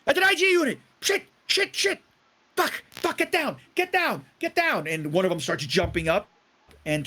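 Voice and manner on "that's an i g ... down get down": much higher, frantic panicked cursing; panicked drunk. Acted. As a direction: scramble in terror at the hunter.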